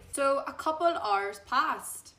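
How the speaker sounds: ordinary talk, nothing marked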